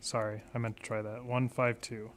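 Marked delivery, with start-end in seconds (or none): none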